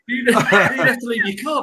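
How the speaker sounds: giggling as he tells it; amused laugh breaking in. Real, not acted. Laughs mid-story.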